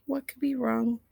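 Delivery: usual speaking voice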